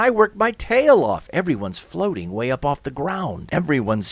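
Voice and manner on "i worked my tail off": high, loud protest; indignant shout. Acted. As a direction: shout it in outrage. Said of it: "turn and shout".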